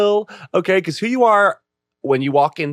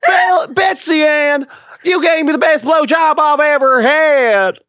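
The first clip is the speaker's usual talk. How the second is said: high, loud, slow Southern holler; hammed-up Southern belle shouting; loud Southern accent; 11 semitones higher, 3 dB louder, slower than usual. Podcast accent game round, hammed-up Southern shout. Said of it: "which is Southern".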